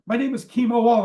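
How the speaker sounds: loud, high acted announcement; emphatic self-introduction. Caller giving his name at the door, loud and emphatic. Stranger repeats name after "What?.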